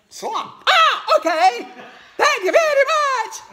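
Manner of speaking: very high, loud squeaky frog, laughing through it; giggly frog voice. Acted. Frog thanking mama pig, high squeaky voice breaking into laughs. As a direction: squeak your thanks while cracking up.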